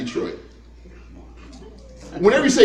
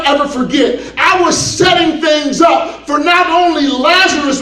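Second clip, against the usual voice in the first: raised, high preaching voice building to a shout; rousing proclamation; 6 semitones higher, 3 dB louder than usual. Acted. Jesus telling Mary what she's about to see, rousing proclamation. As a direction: build from emphatic promise to full-force proclamation.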